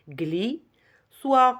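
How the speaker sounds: GLISSOIR in two beats, gli... soir; syllable by syllable. Shown as a demonstration. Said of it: "il y a seulement deux syllabes".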